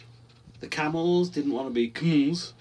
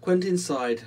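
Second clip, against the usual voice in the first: ordinary delivery, nothing marked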